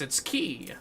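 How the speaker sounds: normal talking voice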